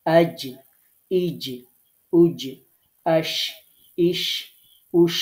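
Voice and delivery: আজ, ইজ, উজ, আশ, ইস, উস one by one, pressing the final j and sh; pressed articulation drill. Shown as a demonstration. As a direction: press the middle tongue to the palate on each ending of আজ, ইজ, উজ, আশ, ইস, উস. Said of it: "সূত্র অনুযায়ী practice করি".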